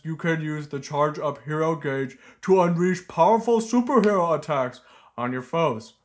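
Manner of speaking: loud, booming cartoon ogre read. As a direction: bellow it like a dopey ogre. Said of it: "I was trying to do an ogre voice".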